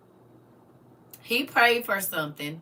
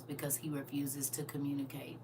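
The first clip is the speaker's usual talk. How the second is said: whispered; 5 semitones lower, 13 dB quieter than usual. Real, not acted. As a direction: drop to a whisper. Whispered mid sentence.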